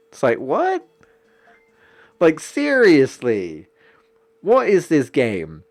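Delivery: ordinary talk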